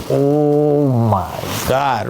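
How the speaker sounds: long drawn-out words; retold winded groan. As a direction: stretch it out like the wind's knocked out. Retold reaction to being winded.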